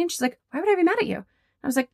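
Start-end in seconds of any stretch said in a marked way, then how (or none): 0.5-1.2 high, incredulous question; pitched-up, disbelieving retold voice; baffled client. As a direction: imitate a client's surprised reassurance. Client puzzled, reassuring her.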